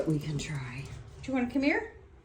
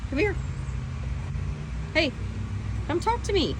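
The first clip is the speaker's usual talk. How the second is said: high pet-talk voice; 9 semitones higher, 5 dB quieter than usual. Real, not acted. Coaxing pet bird to talk.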